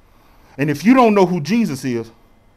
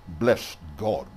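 very low, hushed mock authority; authoritative churchman; 9 semitones lower, 9 dB quieter than usual. Preacher mimicking voice people want, mock authoritative. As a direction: intone it deep and softly, as an act. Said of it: "in an authoritative manner".

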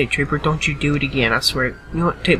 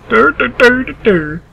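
mock dopey chant; 8 semitones higher, 9 dB louder than usual. Mocking slow dog crossing.

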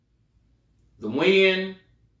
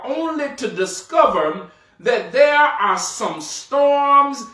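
rising, louder preacher's cadence, building; preaching; 6 semitones higher, 3 dB louder than usual. Acted. Pastor urging his congregation about life's storms, full preaching force. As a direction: drive the list home like a preacher at full force.

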